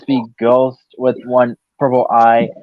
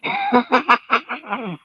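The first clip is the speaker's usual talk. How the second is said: high sneering cackle; evil ghost cackle; 11 semitones higher, 6 dB quieter than usual. Pretend. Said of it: "Ghost Freak's voice".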